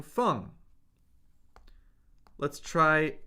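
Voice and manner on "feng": "feng" falling from high; fourth tone. Shown as a demonstration. Teacher cycling four tones on a syllable, "feng" falling. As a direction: start "feng" high and drop it quickly. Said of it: "Let's do the four tones".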